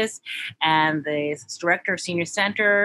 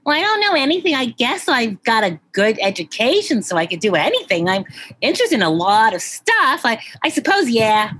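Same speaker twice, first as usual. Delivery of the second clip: lightly played Valley Girl, louder, every line going up at the end; shallow, vapid Valley Girl with uptalk; Valley Girl accent; 3 dB louder than usual. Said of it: "can you do a Valley Girl accent?".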